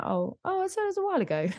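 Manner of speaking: high-pitched, airy brush-off of someone's grief; mock dismissive response. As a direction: imitate someone deciding it no longer counts, voice lifting high. Voices people's dismissive grief response.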